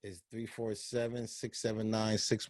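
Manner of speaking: faint and far from the mic; head turned away from the mic. Real, not acted. Reading the show number to listeners with his head off the mic. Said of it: "we didn't hear the beginning because you moved your head".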